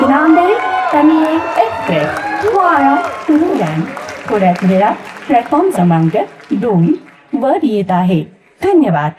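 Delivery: male announcer voice. Shown as a demonstration. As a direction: call the train out on the station mic. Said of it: "मी ते काय म्हणणार?". (पुरुष उद्घोषकाचा आवाज)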